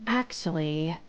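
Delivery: low, aloof interjection. Acted. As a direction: cut in coolly, pitched low. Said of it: "Shimi is aloof".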